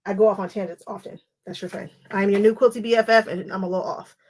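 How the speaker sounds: ordinary delivery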